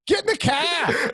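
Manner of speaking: put-on high, slow, thick Boston accent, car without the r; hammed-up Boston accent. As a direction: ham up a Boston guy barking an order. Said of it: "do the Boston accent".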